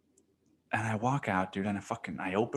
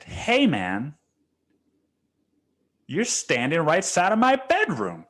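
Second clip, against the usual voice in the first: raised-pitch, indignant callout; re-enacted indignant complaint; indignant confrontation; 6 semitones higher, 3 dB louder than usual. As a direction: confront him, pitch climbing through the complaint. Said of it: "And I said it like that".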